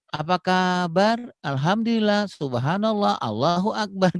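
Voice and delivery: put-on high, slow, sing-song greeting to little kids; over-cheerful teacher opening. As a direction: greet the class like first graders, stretched and high. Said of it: "masih begini gitu". (guru menyapa murid kecil dengan suara tinggi dan lambat)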